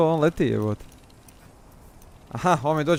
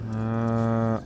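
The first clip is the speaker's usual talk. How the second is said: ordinary delivery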